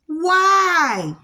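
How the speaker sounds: high, loud challenging Why; rhetorical why. Acted. Preacher challenging listeners on negative thoughts, loud rhetorical why. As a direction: throw the Why? at them.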